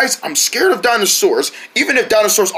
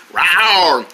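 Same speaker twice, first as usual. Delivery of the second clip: repeated falling rawr; pretend dinosaur roar. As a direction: roar playfully like a toy dinosaur. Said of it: "He's pretending to be a dinosaur".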